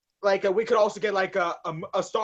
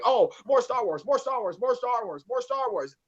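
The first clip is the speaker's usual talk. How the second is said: higher-pitched, repetitive fan chant; mock eager fan; 5 semitones higher than usual. Host mimicking fans who want more Star Wars, mock eagerness. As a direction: imitate fans clamoring for more.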